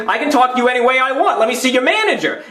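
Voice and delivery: high, heated demand; indignant demand. Retold or imitated. Demanding the manager.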